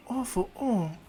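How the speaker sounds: high, puzzled little Eri voice; young Eri. Acted. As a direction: echo it back high as a question. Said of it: "she's like".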